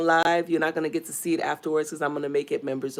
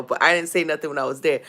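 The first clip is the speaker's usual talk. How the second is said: ordinary talk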